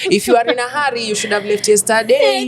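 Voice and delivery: lightly voiced sing-song Coast accent; Coastal Kenyan English accent. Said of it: "Coastarian speaking English with the accent".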